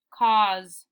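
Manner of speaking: cause as "kawz", long aw, loud; corrected reading of cause. Shown as a demonstration. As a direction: say cause with a full aw vowel. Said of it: "it's cause".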